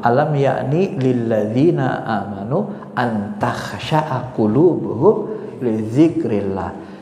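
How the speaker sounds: slow, measured Arabic recitation; Quran recitation. Acted. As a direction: recite the verse with drawn-out syllables. (melantunkan ayat Al-Quran dengan perlahan)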